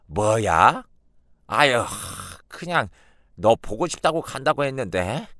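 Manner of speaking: loud, then a sheepish excuse; a caught grandmother fumbling an excuse; flustered grandmother. Acted. (들통나 얼버무리는 외할머니)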